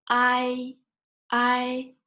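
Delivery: "I" held high and level; first tone. Shown as a demonstration. Teacher combines two finals, "I" high and flat. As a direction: sustain "I" on a high, flat pitch. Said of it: "first tone is a flat tone".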